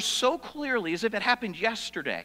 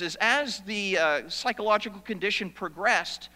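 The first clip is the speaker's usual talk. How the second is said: ordinary talk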